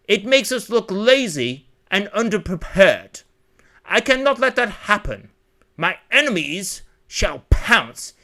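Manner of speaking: scolding drill-instructor father. Acted. Father lecturing servants on intercom timing, pompous scolding. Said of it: "He scolded the servants as he strolled".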